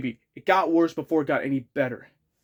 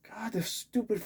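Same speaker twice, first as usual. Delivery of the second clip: whispered grumble; 5 semitones higher, 11 dB quieter than usual. Real, not acted. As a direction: hiss it under your breath, fed up. Muttering about the fly.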